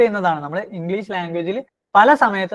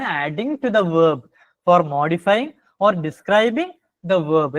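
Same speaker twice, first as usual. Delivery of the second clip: each word held and drawn out; slow dictation; much slower than usual. Shown as a demonstration. Teacher gives the adverb definition as very slow dictation. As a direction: dictate slowly for the students to copy.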